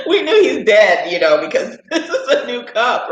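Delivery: laughing through the words, slowing down; real laughter breaking up the telling. Real, not acted. Telling family about the crushed cup, laughing through it.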